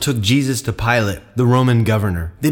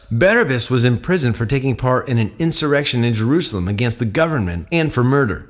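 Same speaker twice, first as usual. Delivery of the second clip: plain reading voice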